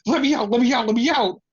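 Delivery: imitating a panicking wrestler begging to get out; mock panic. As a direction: plead to be let out, half-acted. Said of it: "you can see Bianca start panicking".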